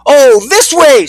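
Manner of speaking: high, loud, drawn-out call; excited yell. A narrator's reading. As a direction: call the others over excitedly. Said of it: "Skip yelled excitedly".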